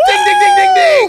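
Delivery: high, loud ringing "ding ding"; excited ding ding. Acted. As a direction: shout it like a winning bell. Host rings correct answer.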